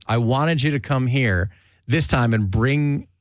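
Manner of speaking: ordinary talk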